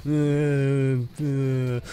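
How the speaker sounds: wordless put-on whining; mock a moaning teammate with drawn-out whines; whiny mock moans; whining imitation. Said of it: "that's what that person sounds like to me".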